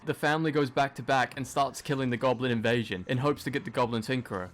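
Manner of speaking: ordinary talk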